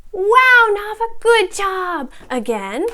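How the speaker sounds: slow, exaggerated teacher voice with high praise; upbeat phonics teacher. Shown as a demonstration. Teacher quizzing a young student on first sounds, slow and animated. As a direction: stretch each word for a young learner. Demo online phonics class.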